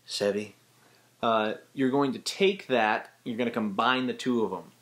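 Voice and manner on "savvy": lightly voiced Jack Sparrow; a hint of the pirate's drawl; Captain Jack Sparrow. Shown as a demonstration. Tutor demonstrating Captain Jack Sparrow's voice. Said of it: "he's voicing like this all the time".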